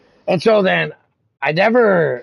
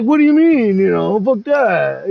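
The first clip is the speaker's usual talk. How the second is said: mock indignant rival; 5 semitones higher, 4 dB louder, slower than usual. Retelling rival's dismissive retort.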